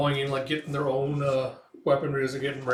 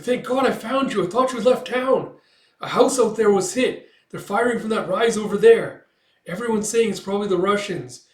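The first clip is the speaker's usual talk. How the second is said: high, loud, urgent report; agitated relief; 8 semitones higher, 8 dB louder than usual. Retold or imitated. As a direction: blurt the news with raised voice. Breathless news on finding his wife.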